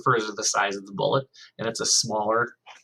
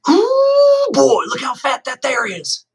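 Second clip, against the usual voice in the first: very high, loud, slow country holler; 10 dB louder, much slower than usual. A narrator's reading. New kid spotting a squirrel, calling out in a high loud holler. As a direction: holler it across the street. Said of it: "hollered out".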